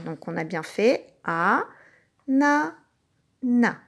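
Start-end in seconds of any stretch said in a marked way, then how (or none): 1.2-3.8 "A, na, na" with a clear gap after each syllable. Shown as a demonstration. Teacher recalls "A, na, na" on the bottle caps, syllable by syllable. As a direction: split "A, na, na" into three separate syllables. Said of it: "dire les syllabes sur les bouchons".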